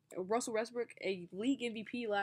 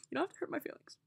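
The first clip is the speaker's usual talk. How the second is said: higher, mock hurt whine; bad acting; 5 semitones higher, faster than usual. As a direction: pout out the hurt feelings. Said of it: "I'm a terrible actor".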